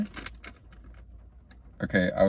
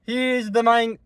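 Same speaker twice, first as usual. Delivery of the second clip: YouTuber voice; 11 semitones higher, slower than usual. Acted. Same YouTuber voice.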